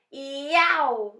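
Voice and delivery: loud drawn-out howl of pain; roar. A narrator's reading. Narrator voicing the sleeping giant in a nightmare, roaring. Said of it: "roared the flesh lump eater".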